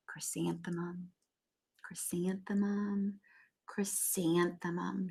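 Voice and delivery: slow, soft whisper of the name; quiet, slowed whisper. Acted. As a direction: sadly breathe the name out. Sad girl writes her name.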